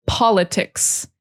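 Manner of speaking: politics with POL stressed, slowly; politics, stress up front. Shown as a demonstration. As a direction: hit the first syllable of politics. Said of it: "emphasis is on the first syllable".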